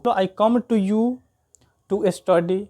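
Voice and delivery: ordinary talk, nothing marked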